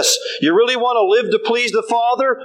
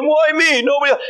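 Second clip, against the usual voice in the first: self-pitying whine; 6 semitones higher than usual. Retold or imitated. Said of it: "Man of pity comes in".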